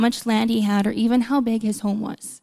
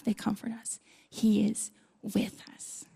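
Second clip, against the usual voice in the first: soft, slow reassuring whisper; quiet tender whisper; whispered comfort; 9 dB quieter than usual. Acted. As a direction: hush it like a comfort to the flock. Comforting verse, hushed.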